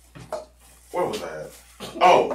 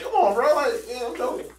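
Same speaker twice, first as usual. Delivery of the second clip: higher, grinning reassurance; smiling, joking; 11 semitones higher than usual. Retold or imitated. Telling the supervisor it's a joke, smiling and higher. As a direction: smile through it, keep it light. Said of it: "I'm smiling like".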